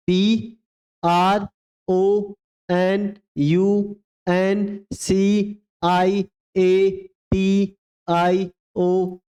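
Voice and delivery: slow letters, each one drawn out; teacher spelling slowly for the class to repeat; slow spelling dictation. Shown as a demonstration. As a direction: dictate so they can spell along. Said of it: "एक बार फिर से बोलेंगे मेरे साथ".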